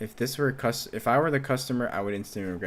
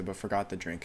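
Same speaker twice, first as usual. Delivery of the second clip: normal talking voice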